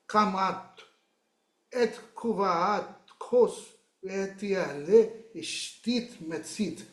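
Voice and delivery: normal talking voice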